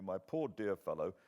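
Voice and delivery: plain reading voice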